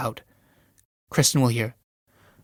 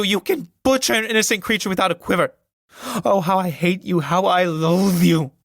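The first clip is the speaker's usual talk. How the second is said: screaming hatred; 4 semitones higher than usual. Acted. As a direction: hurl the hatred at him. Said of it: "Julie, screaming".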